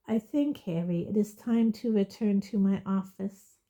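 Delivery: ordinary delivery, nothing marked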